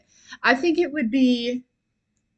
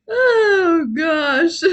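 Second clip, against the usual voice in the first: a gasping oh, then a teary gosh; choked up with happy tears; real happy tears; 6 semitones higher, 6 dB louder than usual. Real, not acted. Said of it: "I don't mean to cry".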